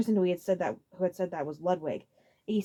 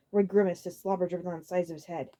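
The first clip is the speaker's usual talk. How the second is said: usual speaking voice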